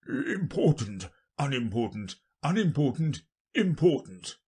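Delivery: musing, word by word with pauses; king trying out words, alternating the pitch; king's undertone. Acted. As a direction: weigh each word to yourself as a king. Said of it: "to himself in an undertone".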